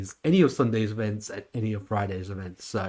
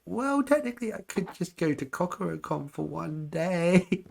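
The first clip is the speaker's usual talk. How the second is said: much higher, slow, sly reasoning; 8 semitones higher than usual. Retold or imitated. Vlogger telling dad about her one-day con plan, high and sly. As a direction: pitch it way up like a sneaky loophole. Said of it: "I was like".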